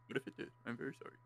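whispered mock apology.

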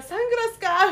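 loud, high hyped exclamation; hammed-up excited quip; hyped mock quote. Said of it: "みたいな".

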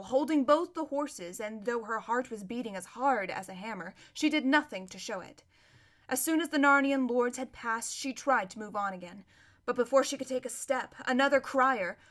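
plain reading voice

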